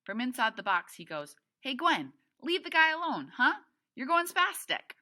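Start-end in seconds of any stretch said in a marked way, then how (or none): 1.6-4.9 loud, high-pitched telling-off; calling out. A narrator's reading. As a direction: call out sharply to be heard from inside a box. Boy calls from inside box.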